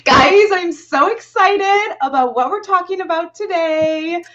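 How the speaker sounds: high, over-the-top gushing parody; mock influencer excitement. Host making fun of influencers, hammed-up excitement. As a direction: ham it up like a livestream influencer. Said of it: "fun of influencers".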